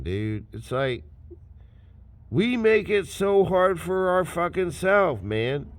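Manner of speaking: ordinary talk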